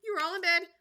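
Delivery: high, loud calling to her chicks; trembling canary mother. Acted. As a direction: call the children in anxiously. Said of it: "called out in trembling voice".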